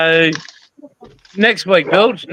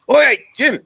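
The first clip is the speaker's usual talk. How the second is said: calling out; 4 semitones higher than usual. Retold or imitated. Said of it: "that's what we'd say".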